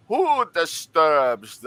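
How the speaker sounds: slow, booming call from the urn; a lightly voiced booming shout. A narrator's reading. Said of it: "you hear a booming voice shout out".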